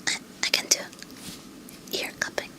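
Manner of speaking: breathy close-mic whisper. Real, not acted.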